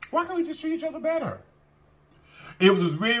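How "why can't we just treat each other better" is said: high pleading. Real, not acted. Pleading repeated, higher.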